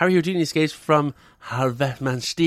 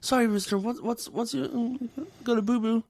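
much higher, cooing; mock baby talk; 8 semitones higher, 4 dB quieter than usual. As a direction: coo as to a toddler. Mock talking to Trump.

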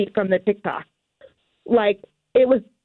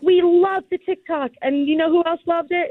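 gushing team member; 7 semitones higher than usual. Retold or imitated. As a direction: sell it like an excited fan staffer. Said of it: "she was like".

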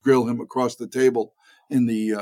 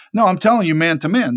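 acting a little tough; 5 semitones higher, 4 dB louder than usual. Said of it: "I'm trying to act a little tough".

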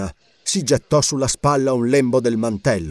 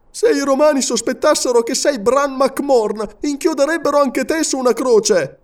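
frightened warning; 13 semitones higher, 3 dB louder than usual. A narrator's reading. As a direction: plead fearfully. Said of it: "esclamò impaurito".

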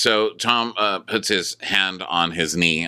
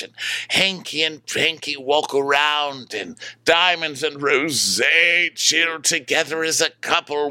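mock dreamy narration; 9 semitones higher than usual. Narrating a fantasy scene.

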